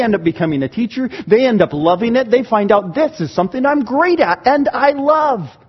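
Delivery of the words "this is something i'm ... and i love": higher, bright-eyed discovery; delighted discovery. Retold or imitated. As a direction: sound like finding a new love. Volunteer discovers gift.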